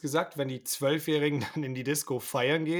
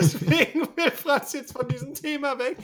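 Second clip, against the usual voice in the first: laughing; 10 semitones higher than usual. Real, not acted. Joking while laughing.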